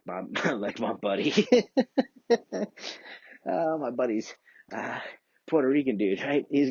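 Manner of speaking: words breaking up in laughter; laughing. Real, not acted. Talking about his buddy while cracking up, laughing. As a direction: giggle through it. Laughing fit mid-story.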